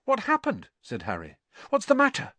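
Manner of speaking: high-pitched urgent asking; startled, pitched well up; alarmed question. A narrator's reading. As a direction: ask it in sudden high alarm. Harry asks terrified cousin what happened.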